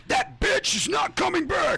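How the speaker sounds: high, slow mock yell; impatient shout. Mocking friend's impatience.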